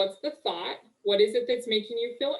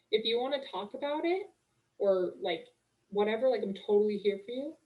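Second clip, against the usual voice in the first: mock sweet sympathy; 5 dB quieter than usual. Wife to her grumpy husband, sugary mock-sympathetic reassurance. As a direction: gush sympathy, then breezily excuse yourself.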